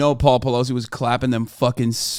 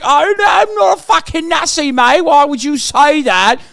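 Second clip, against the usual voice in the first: higher British lad accent, playing innocent; British accent, fake offended denial; mock British accent; 11 semitones higher, 6 dB louder than usual. Streamer imitating a far-right Brit on television in a British accent. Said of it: "they're like".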